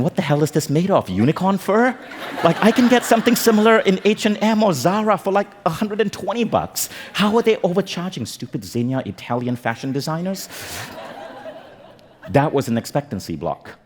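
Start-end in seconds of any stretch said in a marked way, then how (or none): 0.0-10.5 high-pitched, indignant inner outburst; incredulous rant. Retold or imitated. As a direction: rant in mock outrage at the price, voice pitched way up. Acted inner rant about price.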